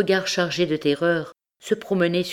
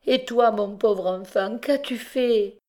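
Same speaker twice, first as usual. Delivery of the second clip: anguished mother; 6 semitones higher than usual. A narrator's reading.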